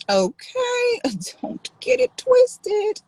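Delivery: high, slow and laughing; sassy warning. Real, not acted. Streamer warning viewers playfully, sassy. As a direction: warn them with a laughing wink.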